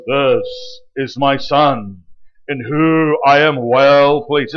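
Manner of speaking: slow, deep, solemn divine voice; voice of God. Acted. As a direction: boom it out slowly like God from the clouds. Voice of God from heaven.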